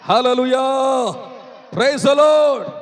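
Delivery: shouted praise from the pulpit; rousing praise shout. Real, not acted. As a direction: call the whole congregation to join in. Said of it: "అందరూ చెప్పండి అమ్మా ఒకసారి".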